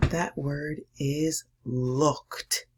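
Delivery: That word is looked, very slow, landing on looked with a final t; slow it right down for the big reveal; drawn-out reveal of looked. Shown as a demonstration. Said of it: "I'm going to show you what that word is".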